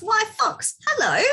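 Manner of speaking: high little mouse voice; cheery mouse greeting. Acted. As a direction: call hello as a tiny mouse.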